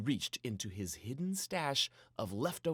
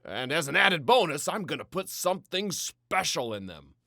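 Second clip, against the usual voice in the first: higher, much louder, playful announcing; twinkle in his eye; 6 semitones higher, 12 dB louder than usual. A narrator's reading. Papa promising pancakes for dinner, with a playful twinkle. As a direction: sell it like a treat. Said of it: "with a twinkle in his eye".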